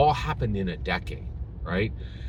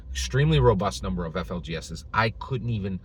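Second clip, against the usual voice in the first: usual speaking voice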